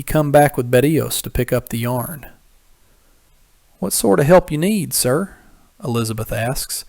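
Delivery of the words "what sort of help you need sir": wary twang. A narrator's reading. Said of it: "with a thick but clear twang".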